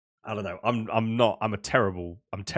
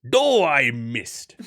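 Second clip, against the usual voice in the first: Wario voice; 2 semitones higher, 8 dB louder, much slower than usual. Retold or imitated. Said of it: "he goes".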